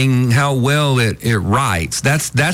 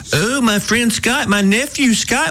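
mocking bragging relative. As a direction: gush like a proud aunt.

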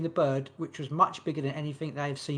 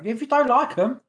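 playfully defensive; 6 semitones higher, 6 dB louder than usual. Real, not acted. Defending her tarot reading.

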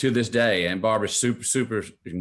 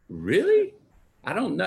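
high doubtful "Really?"; mock surprise; 11 semitones higher, 3 dB louder than usual. Boy doubting mom's plan, high mock surprise. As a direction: sound unsure, half-disbelieving. His own doubt retold.

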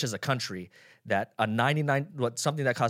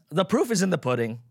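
ordinary talk, nothing marked

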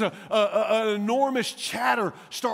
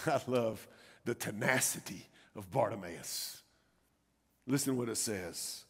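low, quiet admiration; hushed sermon delivery; soft preaching aside; 10 semitones lower, 11 dB quieter than usual. Acted. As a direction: confide it quietly to the congregation.

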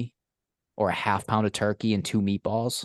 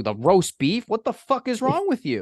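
much higher, incredulous outburst; mock outrage; 13 semitones higher than usual. Berating a friend for ordering roast beef, in high mock outrage. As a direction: act appalled at his choice.

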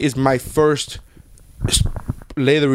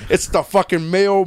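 usual speaking voice

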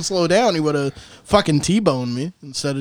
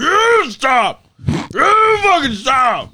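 yelling driver; 8 semitones higher, much slower than usual. Retold or imitated. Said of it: "just going".

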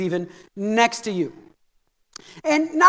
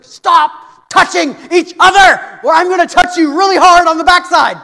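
furious parent yell; 6 semitones higher, 10 dB louder than usual. Retold or imitated. Parent yelling at kids in the car, fed up. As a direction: bellow a threat at squabbling kids.

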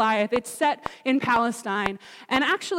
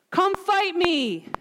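high, drawn-out taunting challenge; taunting yell; 7 semitones higher, much slower than usual. Retold or imitated. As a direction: call out "come at me" like a taunting warrior. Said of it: "in his taunting and yelling".

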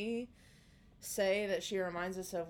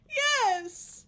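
put-on high, loud kid's yes; high child shout; 9 dB louder than usual. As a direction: yell it like a little girl. Child voiced in story.

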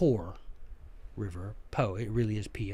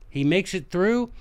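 ordinary talk

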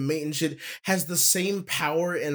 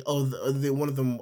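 usual speaking voice

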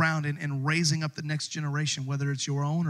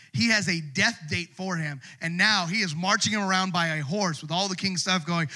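ordinary delivery

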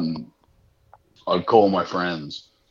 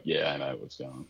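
casual phone voice to a friend; 7 semitones lower, 12 dB quieter, faster than usual. Acted. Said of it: "he'd get on the phone and be like".

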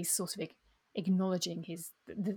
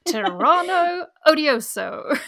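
slow, higher and louder, cast like a wizard's spell; mock spell incantation; 8 semitones higher, 12 dB louder, much slower than usual. Teasing her co-host by echoing the Spanish as a mock spell incantation. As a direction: drag it out like a hammed-up magic spell. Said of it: "Harry Potter spell".

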